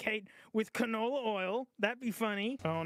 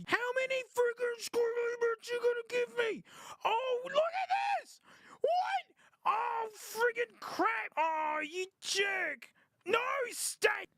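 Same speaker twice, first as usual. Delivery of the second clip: frustrated yelling; 14 semitones higher, slower than usual. Real, not acted. Frustrated at Tetris pieces.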